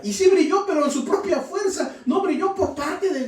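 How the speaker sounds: high, loud preaching climb; preaching at full force. Acted. Preacher exhorting the brothers, climbing to a shout. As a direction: lift the congregation, building to a cry.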